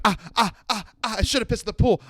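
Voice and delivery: high, soft little "ah" yelps; mock pained yelps. Comic acts out feet burning outside the pool, mock pained yelps. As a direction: yelp lightly, as if stepping on hot ground.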